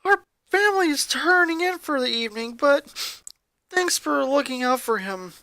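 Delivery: a touch of tired politeness on the phone; Lori's voice. Acted. Sister thanks Bobby before hanging up, lightly voiced.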